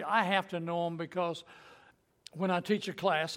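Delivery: ordinary delivery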